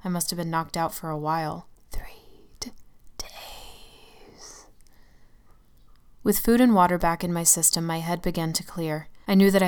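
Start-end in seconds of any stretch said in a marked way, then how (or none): 1.9-4.7 whisper. Acted. Narrator's weak whispered realization.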